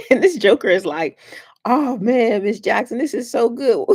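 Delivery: real helpless laughter through the words, pitched high; tickled pink. Real, not acted. Telling a funny story about a child, cracking up laughing. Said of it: "it tickled me pink".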